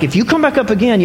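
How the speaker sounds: mildly exasperated big-brother warning; retold frustration. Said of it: "now I'm getting really frustrated".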